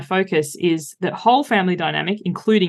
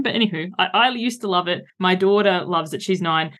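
ordinary talk, nothing marked

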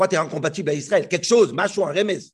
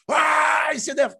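higher mock outburst; a hammed-up flash of temper; mock anger; 8 semitones higher, much slower than usual. Teacher imitating how Moses seems to lose his temper. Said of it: "on a l'impression".

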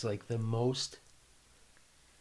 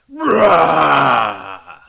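loud retching roar like a monster; monster vomiting roar; 17 dB louder than usual. Shown as a demonstration. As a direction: roar it out like something possessed. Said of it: "And then you wait a minute".

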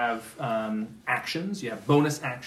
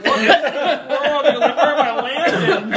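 laughing; 12 semitones higher, 9 dB louder, much slower than usual. Real, not acted. Laughter around the table.

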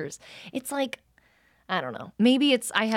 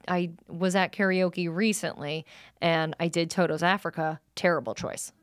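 normal talking voice

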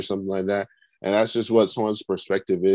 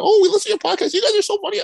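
very high squeaky fan gush; excited fan voice; 5 dB louder, faster than usual. Retold or imitated. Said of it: "someone like".

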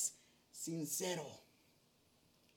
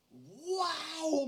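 put-on higher, amazed child's wow; little girl's wow; 5 semitones higher than usual. Father imitating his toddler daughter's amazed wow. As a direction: gasp it like a wide-eyed toddler. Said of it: "y dice".

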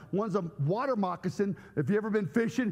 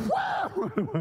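punch sound effect; 14 semitones higher than usual. Retold or imitated. Wife punches his arm.

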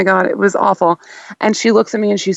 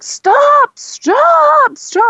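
frantic stop yell; much slower than usual. Retold or imitated. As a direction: yell stop to halt the truck. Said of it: "He's like".

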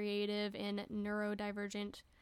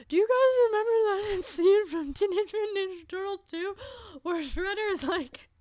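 excited blurt; 11 semitones higher, 12 dB louder than usual. Retold or imitated. Blurting an excited movie reference.

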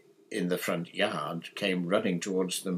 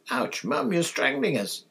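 higher, louder squawk of complaint; pained protest; 6 semitones higher, 5 dB louder than usual. A narrator's reading. Son hugged too hard by his mother, pained protest. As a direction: yelp it as if squeezed too tight.

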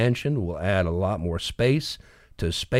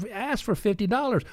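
ordinary talk

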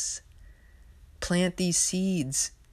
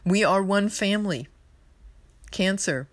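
ordinary delivery

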